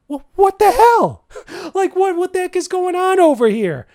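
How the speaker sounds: high, stretched what the hell; real incredulity, almost laughing; exasperated disbelief. Real, not acted. Vlogger reacting to the top list, high exasperated disbelief.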